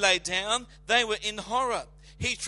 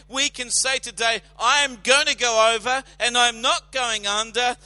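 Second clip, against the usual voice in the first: loud, high, rousing declaration; fiery sermon climax; full-force preaching; 3 semitones higher, 7 dB louder than usual. Acted. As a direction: preach it to the back row.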